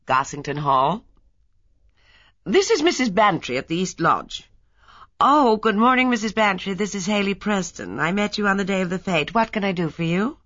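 put-on quick, businesslike mid-Atlantic accent; clipped efficient American secretary; brisk transatlantic voice. A narrator's reading. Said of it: "A brisk transatlantic voice spoke".

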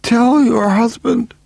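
slow, very high strained voice; dying woman. A narrator's reading. Dying woman leaves a message for the husband, strained. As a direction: labour each word high and urgent.

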